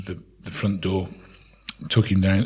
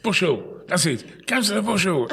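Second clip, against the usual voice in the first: excited and higher, the name found; relieved remembering; 10 semitones higher than usual. Retold or imitated.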